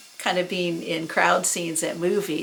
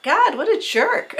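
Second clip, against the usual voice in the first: exasperated thought; 11 semitones higher, 4 dB louder than usual. Retold or imitated. Private thought about rude stranger.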